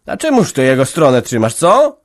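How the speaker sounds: loud, sharp demand rising high on the end; menacing question. A narrator's reading. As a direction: threaten her with the question. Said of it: "zapytała groźnie". (groźne pytanie matki)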